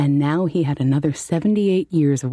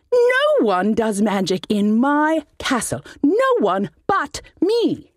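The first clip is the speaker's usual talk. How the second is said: higher, slow and commanding; imperious wizard; 10 semitones higher, slower than usual. Acted. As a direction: lay down the law as the wizard. Wizard asserts power.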